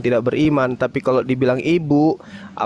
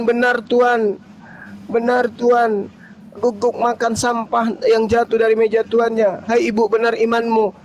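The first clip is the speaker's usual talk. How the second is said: retold dialogue voice; 7 semitones higher than usual. Voicing woman and Jesus.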